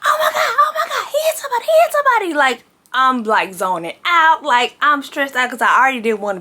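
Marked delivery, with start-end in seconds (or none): 0.0-2.3 high, frantic crying yell; reliving the panic at full pitch; hysterical panic. Retold or imitated. Panicking passenger screaming after the crash, hysterical. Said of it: "I went hysterical".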